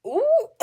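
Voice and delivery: high, loud "ooh" then a laugh; shrieking ooh and laughter; shocked laugh. Real, not acted. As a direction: squeal at the nerve of it, then laugh. Reacting to outrageous text.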